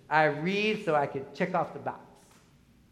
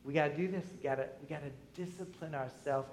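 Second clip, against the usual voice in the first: ordinary delivery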